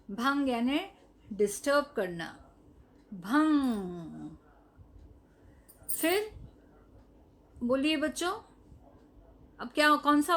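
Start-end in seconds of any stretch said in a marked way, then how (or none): 3.1-4.3 slow, stretched भंग, voice lower; teacher repeating भंग slowly for pupils; drawn-out model word. Shown as a demonstration. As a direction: draw out भंग slowly and low. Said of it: "के ऊपर बिंदी है".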